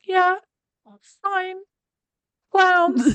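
mimicking a high, unconvincing "I'm okay"; high-pitched. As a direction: pitch it far up, as if feigning calm. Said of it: "in a high-pitched voice".

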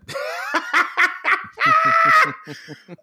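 big high-pitched burst of laughter. Real, not acted. Guest laughing at his younger self, high laughter. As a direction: laugh hard at your own naive boast.

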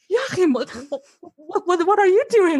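high, stammered disbelief; acted-out alarm, pitch climbing; startled. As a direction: replay being taken aback by your partner. Acts out her startled reaction to him.

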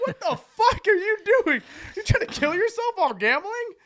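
very high, loud disbelief; hammed-up shocked outburst; incredulous yell. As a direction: shout it at a friend, half laughing. Acted disbelief at drunk roommate in bathtub.

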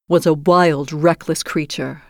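ordinary delivery, nothing marked

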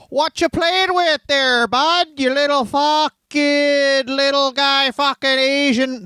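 high, slow Canadian bully voice; small-town Canadian bully mocking a kid. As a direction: sneer at a nerdy kid like a Winnipeg tough. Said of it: "imagine a young Tyson Smith, growing up around guys like this".

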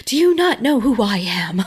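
laughing through a mock snooty demand; lightly hammed-up entitled tone with a laugh; mock haughty. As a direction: play the offended self-important version of yourself, half laughing. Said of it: "right, where it's like".

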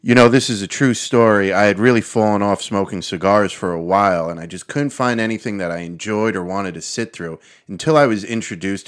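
loud, earnest sponsor pitch; loud ad testimonial. Acted. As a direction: sell it hard and loud.

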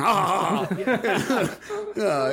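hammy high evil cackle; villain laugh. Retold or imitated. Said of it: "then they laugh".

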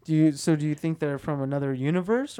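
usual speaking voice

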